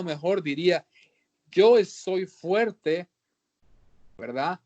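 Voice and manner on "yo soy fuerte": slow emphatic declaration. Acted. Preacher restates the verse emphatically.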